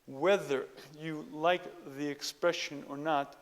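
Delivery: ordinary talk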